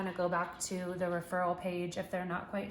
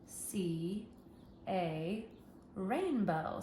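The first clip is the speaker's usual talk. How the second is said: slow, paused, one word at a time; word by word reading; 3 dB quieter, much slower than usual. Shown as a demonstration. Teacher reading sentence aloud with children, pointing at each word. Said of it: "have them point to the words".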